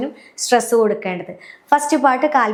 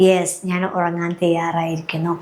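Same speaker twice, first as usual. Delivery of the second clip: low, calm self-affirmation; lower-pitched bedtime affirmation; self-instruction; 5 semitones lower, 3 dB quieter than usual. Shown as a demonstration. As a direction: tell your own body and mind, low and settled. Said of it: "നമ്മൾ instruction കൊടുക്കുകയാണ്".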